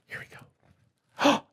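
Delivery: breathy high whisper, slow; slow suspense whisper. Acted. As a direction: hush them before the big moment. Suspense before the crack.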